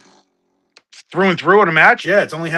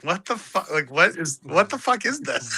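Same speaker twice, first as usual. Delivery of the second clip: softer, slowed, disbelieving; baffled mutter; 7 dB quieter, slower than usual. Retold or imitated. Watching the strut every time, quiet baffled disbelief. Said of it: "I'm like".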